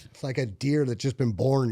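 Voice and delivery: ordinary talk, nothing marked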